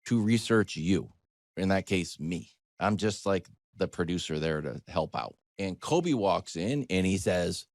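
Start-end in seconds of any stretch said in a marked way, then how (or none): none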